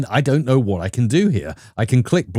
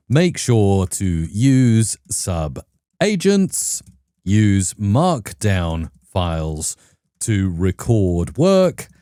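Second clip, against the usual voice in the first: very slow, lower, word by word; typing aloud; 4 semitones lower, much slower than usual. Real, not acted. Host types a prompt for Claude Code, slow word-by-word delivery. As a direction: read out slowly as you type, with pauses.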